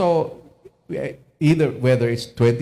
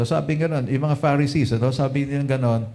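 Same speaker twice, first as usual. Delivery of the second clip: usual speaking voice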